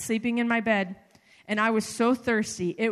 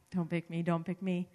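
hushed plea; 6 semitones lower, 8 dB quieter than usual. Retold or imitated. Silent staff hoping not chosen.

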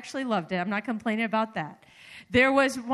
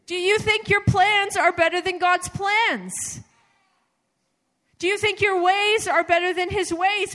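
preaching at full force; 7 semitones higher, 6 dB louder than usual. Acted. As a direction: press the questions on the crowd. Preaching challenge to the congregation.

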